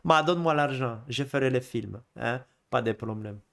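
put-on Italian accent that comes out Russian; botched Italian accent. Director demanding money for films, bad Italian accent. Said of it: "je fais l'accent russe".

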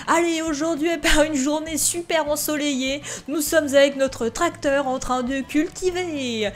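playful fake commercial voice, cracking up by the end; mock ad announcer. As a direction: sell the farm like a cheesy TV advert, half laughing.

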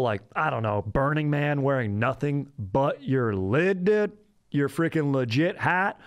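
hyped-up surfer-dude sales patter; bro ad read. Acted. Podcast host pitching hats to listeners, hammed-up bro ad read. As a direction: stretch out the key words like a stoked bro.

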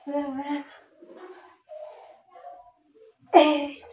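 straining while counting reps; doing push ups. Real, not acted. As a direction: count through the effort.

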